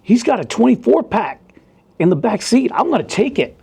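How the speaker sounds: high, whiny mock voice of the other cop; mocking the greedy officer. Mocking the coworker who wants the beer, high whiny voice. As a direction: ham up the beer-grabbing coworker. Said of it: "and he's like".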